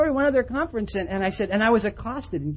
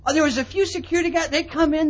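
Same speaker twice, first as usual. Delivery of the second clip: ordinary delivery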